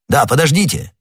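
understated narrated shout; calling out. A narrator's reading. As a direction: call after someone to stop them. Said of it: "крикнул де Тревиль".

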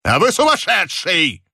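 irritated detective's exclamation; angry shout. A narrator's reading. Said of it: "гневно закричал сыщик".

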